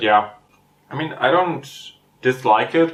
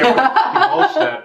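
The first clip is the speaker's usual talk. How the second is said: higher, laughing aside; 14 semitones higher, 3 dB louder, slower than usual. Real, not acted. Woman joking to friend about age, laughing. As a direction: laugh at yourself.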